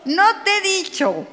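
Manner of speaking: high-pitched acted scolding; high, exasperated telling-off; exasperated reproach. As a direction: remind them with mock impatience. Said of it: "Pero hay que ponérselo".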